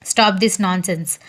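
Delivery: ordinary delivery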